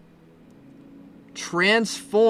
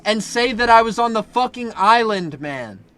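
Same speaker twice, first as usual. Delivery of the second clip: loud, high-pitched yelling rant; worked-up, raised-voice complaint; exasperated outburst; 6 semitones higher, 8 dB louder than usual. Real, not acted. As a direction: yell at the troll in exasperation. Exasperated outburst at a trolling chatter.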